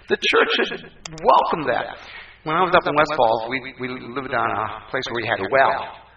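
ordinary delivery

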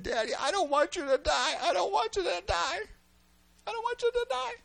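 put-on high-pitched pleading child voice; crying little boy. Son begging his dad to quit smoking, high tearful child voice. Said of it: "What are you crying about?".

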